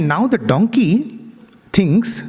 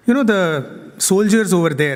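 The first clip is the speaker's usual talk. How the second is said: normal talking voice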